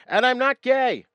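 sudden loud high blurt. Retold or imitated. Turning back to the celebrities, blurting out loudly.

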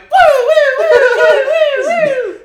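very high, loud siren wail. Pretend. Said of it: "Making siren noises with your mouth".

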